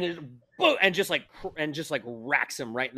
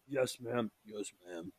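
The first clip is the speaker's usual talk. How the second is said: soft, chastened reply; meek obedience; 4 semitones lower, 9 dB quieter, slower than usual. Acted. Two scolded brothers answering the woman, softly and meekly. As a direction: mumble it like scolded boys.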